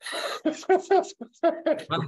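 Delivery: high, rolling real laugh; long burst of high laughter; amused laughter. Real, not acted. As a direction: laugh hard at your own joke. Laughs at own joke.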